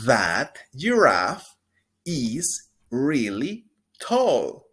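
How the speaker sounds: slow, loud, clear teacher model; drawn-out pronunciation model; slow model sentence. Shown as a demonstration. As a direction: stretch each word for repeating. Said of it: "Repita después de mí".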